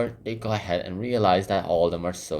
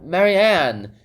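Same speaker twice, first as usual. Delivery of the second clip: high, louder bossy call; bossy rabbit; 10 semitones higher, 7 dB louder, much slower than usual. Retold or imitated. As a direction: summon a servant sharply. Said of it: "he's all like".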